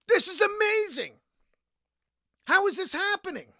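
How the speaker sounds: high, thrilled disbelief; amazed delight. Retold or imitated. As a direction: gush with amazement. Overjoyed at the offer.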